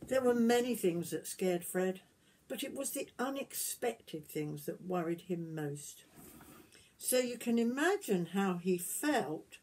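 gentle storybook reading voice; reading to children. Acted. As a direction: tell it like a bedtime story to little ones. Reading picture book aloud.

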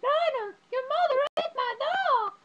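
very high, loud, drawn-out shriek; outraged screech, imitating the film; movie character shriek. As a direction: scream it in a hammed-up falsetto. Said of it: "And she's like".

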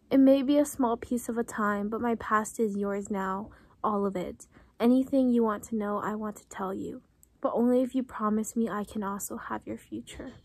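soft, fast reading from the book, almost whispered; quiet reading aloud. A narrator's reading. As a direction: read the passage under your breath, quickly.

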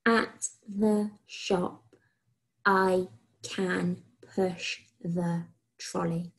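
very slow, each word spaced out; slow dictation. Shown as a demonstration. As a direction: dictate it slowly for children to write. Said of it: "Okay, one last time".

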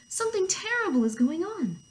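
high-pitched dramatic warning; acted urgent hen; alarmed cry. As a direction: announce trouble in storybook alarm. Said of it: "cried Hen".

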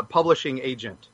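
usual speaking voice

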